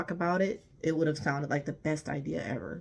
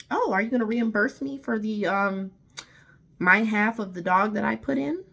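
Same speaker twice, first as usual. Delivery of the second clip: mocking her demand; 5 semitones higher, 6 dB louder than usual. Quoting her reimbursement demand.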